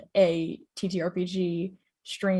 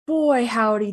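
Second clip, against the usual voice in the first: ordinary talk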